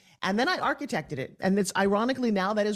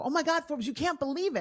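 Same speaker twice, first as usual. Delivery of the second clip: mock amazed; 8 semitones higher than usual. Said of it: "they'll call me and they'll go".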